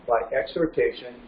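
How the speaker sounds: ordinary delivery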